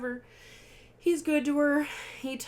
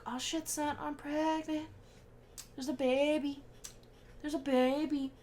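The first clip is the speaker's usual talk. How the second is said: mock excited Rosemary; 7 semitones higher than usual.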